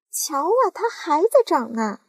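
high, loud cry of an aunt; excited cry at the peach. Acted. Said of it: "叫了起来".

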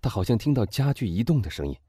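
ordinary delivery

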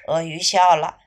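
usual speaking voice

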